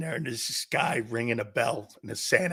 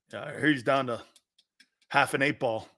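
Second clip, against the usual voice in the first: ordinary delivery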